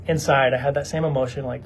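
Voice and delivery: ordinary delivery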